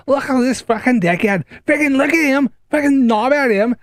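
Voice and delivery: high, furious Scouse rant; high, raging Liverpool accent; angry Scouse accent. Retold or imitated. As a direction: let rip in full Scouse at the lot boy. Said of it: "Why am I going really Scouse?".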